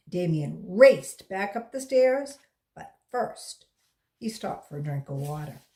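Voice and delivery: plain reading voice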